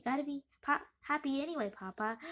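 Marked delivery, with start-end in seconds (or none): none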